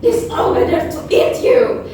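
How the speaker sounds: put-on spring the threat on the little girl; menacing wolf.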